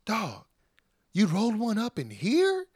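high, mock-incredulous question. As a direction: play astonished, pitch jumping up. Acting [name]'s incredulous question.

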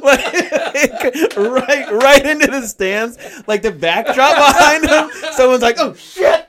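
laughing hard and high. Real, not acted. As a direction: crack up while telling it. Laughing while retelling the wild pitch.

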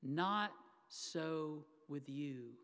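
slow it right down and let it sink in; slow repeat to sink in. Shown as a demonstration. Preacher repeating Jesus's words so they sink in, very slow and soft. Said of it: "let those four words kind of sink in".

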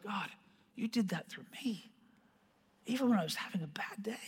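very soft, near-whispered prayer; quiet, humbled wonder; hushed awe. Retold or imitated. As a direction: drop to a whisper of amazement. Awed private word to God.

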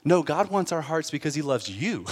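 ordinary talk, nothing marked

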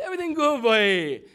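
loud, high Bahamian greeting; Bahamian accent. Retold or imitated. Pastor chatting with the Bahamian worker in a loud Bahamian accent. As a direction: belt it out in Bahamian dialect. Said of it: "I brought out my Bahamian dialect".

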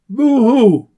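hammed-up boo hoo sob; mock crying. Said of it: "don't cry".

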